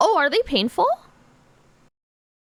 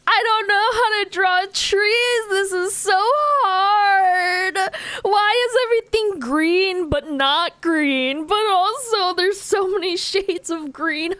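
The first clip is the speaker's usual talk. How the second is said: mock crying whine; 8 semitones higher, slower than usual. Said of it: "I was crying".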